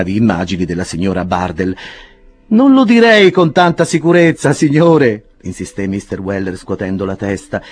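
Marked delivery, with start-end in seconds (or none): 2.5-7.6 higher, louder, lively servant voice; a sly servant teasing his master; cheeky servant Sam. Acted. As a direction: Sam announces a lady to Pickwick, cheeky. Sam teasing Pickwick at the door.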